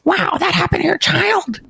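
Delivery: high, exaggerated gravelly impression; Jordan Peterson impression. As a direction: overdo a gravelly pundit voice. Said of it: "overly gravelly voice".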